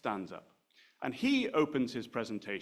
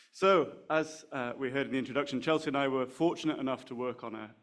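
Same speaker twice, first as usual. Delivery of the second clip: usual speaking voice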